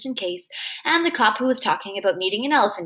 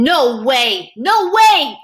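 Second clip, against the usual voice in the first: going nuts; 3 semitones higher, 8 dB louder, much slower than usual. A narrator's reading. Boy learns of his friend Kevin's death, frantic shout. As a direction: shout it in mounting disbelief. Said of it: "I just go nuts".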